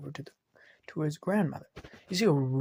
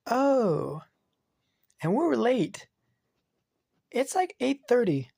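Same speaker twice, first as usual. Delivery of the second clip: put-on high and loud, worried little girl; excited child; 9 semitones higher, 5 dB louder, slower than usual. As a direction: blurt it out like a kid who's late. Child realizing they're late.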